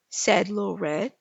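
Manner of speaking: ordinary delivery, nothing marked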